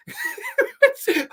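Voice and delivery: high-pitched burst of laughter; real laugh. Real, not acted. Reviewer cracking up at the bizarre scene, high laugh.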